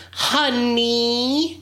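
drawn-out rising honey, mimicking the biker lady; a light impression of the biker's honey; mocking Mother Wheels. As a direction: tease her sing-song honey. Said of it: "how she kept going".

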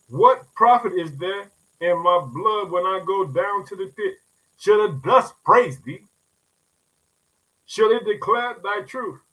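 slow, weighty psalm reading; preacher reading the psalm; solemn scripture reading. Acted. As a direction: read each question slowly and heavily.

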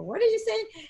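high, surprised what did you say; retold disbelief; startled question. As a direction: caught off guard by the phone call. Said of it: "and I, I go".

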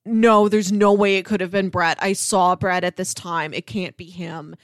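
ordinary talk, nothing marked

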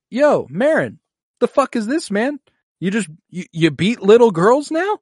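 high, loud, incredulous challenge; indignant challenge. Retold or imitated. Acted confrontation: Tyrion rebukes Meryn.

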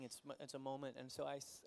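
ordinary delivery

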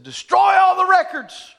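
high, loud royal command; furious royal shout; king's shouted order. Acted. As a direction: shout the decree. The king orders at the desk.

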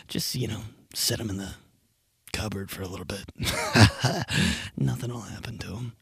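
sly tempting devil voice, half-whispered; devil on shoulder. Pretend. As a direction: coax like the little devil on your shoulder. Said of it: "that's the devil on my shoulder".

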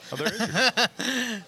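high, slow, drawn-out coo. Pretend. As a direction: coo it out like greeting a baby. Mocking the dad's arrival.

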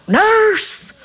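high-pitched mock patient call. Patient calling for the nurse instead of pressing the button, high drawn-out call. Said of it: "he just calls out".

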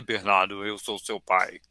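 lightly voiced dramatic dark lord; Darth Vader voice. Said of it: "eu já peguei assim e falei".

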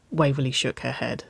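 ordinary delivery

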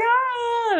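high mock whimper of a crying little girl; imitated toddler cry. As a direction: whine it like a tired toddler. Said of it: "this little girl's crying".

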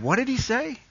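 high, thin, faraway voice; straining to hear. Retold or imitated. Crowd member at the back calls out, high and puzzled. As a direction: squeak it like a confused man far back.

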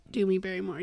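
ordinary delivery

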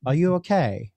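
lightly imitated British accent. Mystic leader checks on stranded student, lightly mimicked British accent. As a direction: put on a touch of British accent. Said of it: "suddenly in a British accent".

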